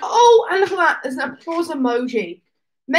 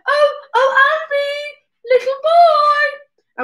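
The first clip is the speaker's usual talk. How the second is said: much higher, slow, cooing call; doting parents; 13 semitones higher, 5 dB louder, much slower than usual. Retold or imitated. Parents cooing at their little boy, high and slow. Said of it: "They went".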